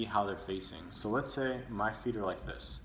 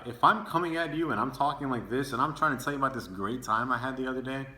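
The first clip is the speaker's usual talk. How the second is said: loud, higher and eager, piling it on; overexcited, too much; 4 semitones higher, 6 dB louder than usual. Shown as a demonstration. Speaker showing the class an overbearing tone, loud and overexcited. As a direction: come on way too strong, bursting to share a story. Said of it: "this is too much".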